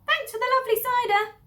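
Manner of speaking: high, loud teasing call; cheeky farewell call. Acted. The thieves call goodbye to the rat, high and loud. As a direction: sing it out cheekily.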